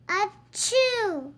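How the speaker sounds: loud hammed-up sneeze; mock sneeze. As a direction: sneeze it out big. Character sneezes in story.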